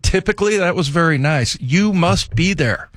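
drip fake niceness over each word; mock sarcastic coach. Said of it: "like, pissed off voice".

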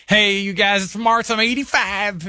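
put-on high-pitched old lady greeting; Martha. Old lady introducing herself on Instagram, high, chirpy voice. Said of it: "she's eighty-five years old. She said".

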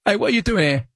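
talking cat in mock-British voice; British accent. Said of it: "What's going British accent, Nanny?".

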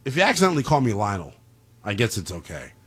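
ordinary talk, nothing marked

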